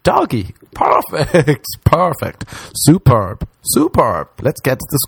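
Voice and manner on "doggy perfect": ordinary talk, nothing marked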